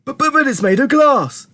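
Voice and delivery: high, stammering protest; stammer, then cry out in alarm; high-pitched spluttering panic; panicked shout. A narrator's reading. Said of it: "shouted Grandpa Joe".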